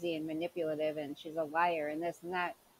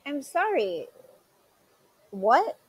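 mocking mimicry; 5 semitones higher, 7 dB louder, slower than usual. Mocking Leticia's own retort.

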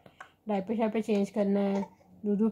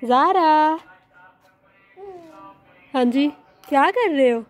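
calling out; 6 semitones higher, 8 dB louder than usual. Real, not acted. Mother calls to baby Zara outside in the sun, loud and high. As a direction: call across the yard to her.

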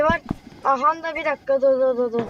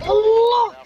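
startled cry; 7 semitones higher, 6 dB louder than usual. Real, not acted. Brakes fail on the car.

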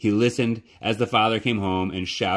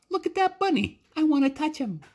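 put-on small child voice.

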